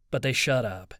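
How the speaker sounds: usual speaking voice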